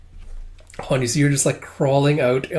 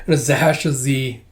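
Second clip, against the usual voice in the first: plain reading voice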